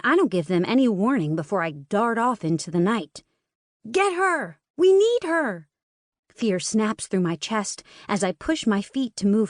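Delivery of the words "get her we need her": shouting. Acted. Twins chasing the fleeing girl.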